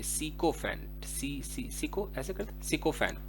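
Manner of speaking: sycophant said with SI as in sick, slowly; soft, careful sycophant with a short si; correct pronunciation. Shown as a demonstration. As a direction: stretch out sycophant, SI-ko-fant. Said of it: "सी करके पढ़ेंगे".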